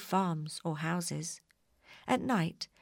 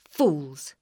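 angry; 2 semitones lower, 8 dB louder than usual. A narrator's reading. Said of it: "said the witch".

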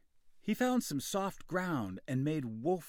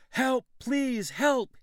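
high, loud cries for help; desperate shouting; 14 semitones higher, 10 dB louder, slower than usual. A narrator's reading. Boy calling to the village for help, loud. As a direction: scream for help in real panic. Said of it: "he shouted".

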